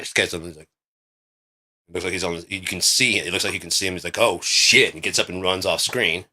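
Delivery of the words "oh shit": louder, higher startled curse; mock alarm; startled swearing. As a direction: act suddenly caught out. Acts startled guy on screen.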